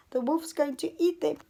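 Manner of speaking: ordinary delivery, nothing marked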